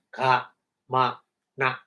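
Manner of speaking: "Ka, ma, na" split into three separate syllables with pauses. Shown as a demonstration. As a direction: spell out Ka, ma, na one syllable at a time. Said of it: "three syllables".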